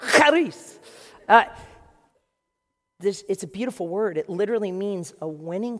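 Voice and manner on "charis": "Charis" with a harsh throaty kh, much higher and louder; spitting Greek charis. Shown as a demonstration. Preacher warning the front row, then a loud spitting kh in Charis. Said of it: "Front row, baby, here it comes".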